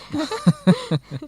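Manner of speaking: short amused laugh at her own joke; light laugh. Real, not acted. Researcher jokes about bringing a new hairstyle home, light laugh. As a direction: laugh lightly, a little high. Joking about starting a hair trend.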